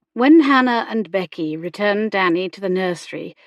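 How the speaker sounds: plain reading voice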